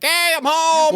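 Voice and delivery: high mock dad arrival call. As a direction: imitate a dad hollering through the house. Dad calls out arriving home.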